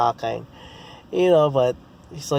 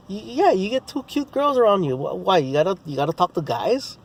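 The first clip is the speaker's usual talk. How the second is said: higher, needling taunt; teasing higher mock; mocking jab; 6 semitones higher than usual. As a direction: poke fun at him for ignoring the girls. Mocking the heckler back.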